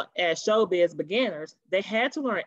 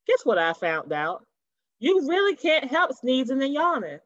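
usual speaking voice

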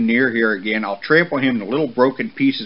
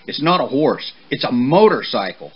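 loud rant rising in pitch; angry, scolding father; 4 semitones higher, 5 dB louder than usual. Acted. Father horse forbidding his son's new friendship, loud and indignant. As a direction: build the outrage item by item.

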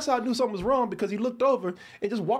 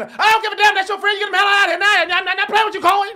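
very loud, high screaming dad; father's raging shout; 11 semitones higher, 11 dB louder, faster than usual. Retold or imitated. Father screaming at son to remove his friend, furious shout.

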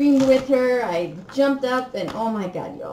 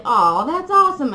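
imitating friend's excitement; 6 semitones higher, 7 dB louder than usual. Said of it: "and she's like".

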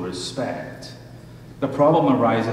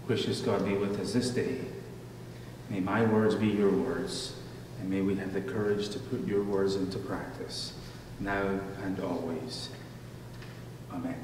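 soft, hushed prayer voice; 2 semitones lower, 8 dB quieter than usual. Acted. Pastor leading the congregation in prayer, soft and reverent. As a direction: pray quietly on the congregation's behalf.

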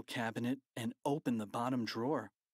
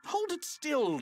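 higher, louder impatient snarl; 4 dB louder than usual. A narrator's reading. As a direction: bark it out, fed up. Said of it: "snarled Mister Krupp".

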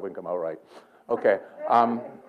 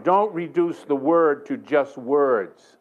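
drawn out slowly, weighing "words"; slow, emphatic admonition; much slower than usual. Retold or imitated. Preacher passing on what God told him, slow emphatic admonition. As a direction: stretch "word" and "words" deliberately.

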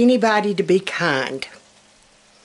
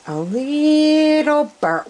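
rueful drawn-out sigh; 7 semitones higher, much slower than usual. Real, not acted. Rueful remark on burnt pancakes.

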